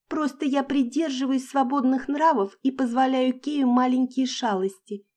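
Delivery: high squeaky mocking noblewoman; squeaky parody. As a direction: mimic a snooty lady, squeaky. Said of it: "писклявым голосом спародировала".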